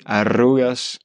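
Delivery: "Arrugas" with a pressed trilled rr; rolled r. Shown as a demonstration. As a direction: roll the rr in "Arrugas" hard. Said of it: "il est plus appuyé".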